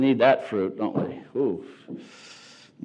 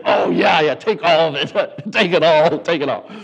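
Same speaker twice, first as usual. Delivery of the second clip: louder, laughing give-it-all cry; hammed-up shout of giving it all; mock eager shout; 6 semitones higher, 10 dB louder than usual. Mocking congregation's giving frenzy.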